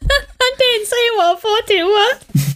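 slow, high, cracking up; laughing. Real, not acted. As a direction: barely get it out for laughing. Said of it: "laughing mid-quiz".

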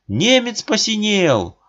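громкий высокий крик; выкрикнуть новость; кричат. A narrator's reading. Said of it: "Кричат". (толпа кричит)

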